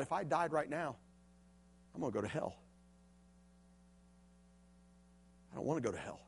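soft, hushed and scared; almost to yourself, afraid of hell; frightened inner thought. Retold or imitated.

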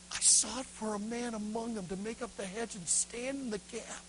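acted weeping over scripture; tearful reading. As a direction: recite the verse as if breaking into tears. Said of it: "he starts weeping".

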